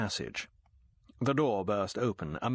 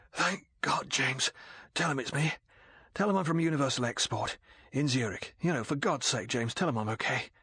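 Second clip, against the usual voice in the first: a battered man begs hoarsely, raised and desperate; hoarse plea; 4 semitones higher than usual. A narrator's reading. Beaten agent hoarsely begs Bond to vouch for him. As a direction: grab at Bond like a life buoy. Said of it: "he said hoarsely".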